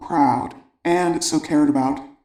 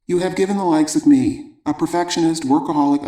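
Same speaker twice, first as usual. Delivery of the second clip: ordinary talk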